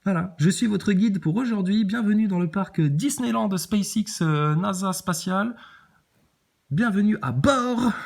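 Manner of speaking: high, slow, cheery welcome announcement; theme park guide. Retold or imitated. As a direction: play a gushing Disney ride host. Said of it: "C'est une attraction Walt Disney".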